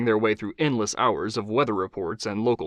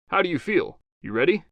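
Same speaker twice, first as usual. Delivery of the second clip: higher, upbeat producer; 7 semitones higher than usual. Acted. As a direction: check on her, a bit higher.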